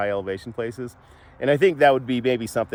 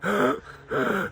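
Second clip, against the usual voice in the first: gasp; 10 semitones higher than usual. Retold or imitated. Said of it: "she would be just like".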